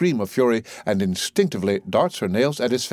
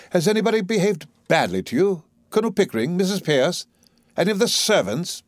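haughty, raised-pitch questioning; lofty; 8 semitones higher than usual. A narrator's reading. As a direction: question her from on high. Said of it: "in his loftiest manner".

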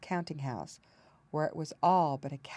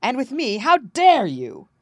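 outraged exclamation; 8 semitones higher, 11 dB louder than usual. A narrator's reading. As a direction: explode at the impudence. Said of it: "exclaimed the king".

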